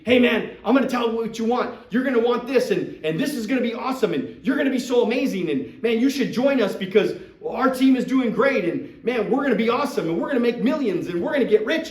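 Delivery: loud, high, gushing salesman hype; overhyped pitch. Retold or imitated. Mocking a pushy recruiter who oversells, loud and high. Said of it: "Don't do that. That's just dumb".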